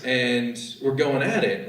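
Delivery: normal talking voice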